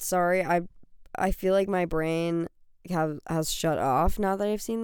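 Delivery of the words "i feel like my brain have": ordinary talk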